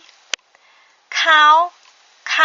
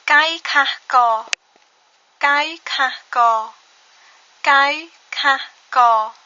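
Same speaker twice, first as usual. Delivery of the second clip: Kai Kha Ko said very slowly, syllable by syllable, louder; slow model reading; 4 dB louder, much slower than usual. Shown as a demonstration. Teacher reads the sentence aloud slowly for learners. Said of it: "Donc cette phrase se lit".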